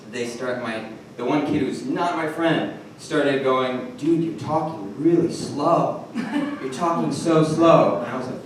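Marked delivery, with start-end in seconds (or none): 4.0-8.0 slow drawl. Retold or imitated. Kid mockingly drawls at the narrator.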